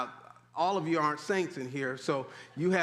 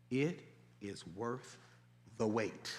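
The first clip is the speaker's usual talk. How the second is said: soft, lower, drawn-out "It is worth the wait"; hushed emphasis; 9 semitones lower, 9 dB quieter, slower than usual. Shown as a demonstration. Pastor pressing the point home to the congregation, hushed. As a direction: lean in and let the words sink in. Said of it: "What did I say?".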